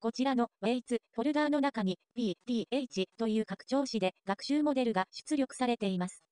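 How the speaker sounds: ordinary talk, nothing marked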